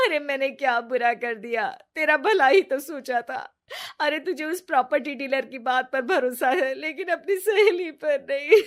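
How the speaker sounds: crying, pleading. Acted. Ritu sobs to Riya after being exposed, loud teary pleading. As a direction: cry and plead to win back her friend. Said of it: "जोर जोर से रोने लगती है".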